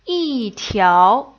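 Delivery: "yi" falling, "tiao" rising; fourth tone yi. Shown as a demonstration. Teacher shows the changed tone, "yi" falling. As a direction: drop "yi", then swing "tiao" up. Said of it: "will change into a fourth tone".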